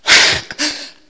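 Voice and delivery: a breathy gasp of shock; the cop can't believe his eyes; stunned gasp. Retold or imitated. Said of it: "this cop sitting there going".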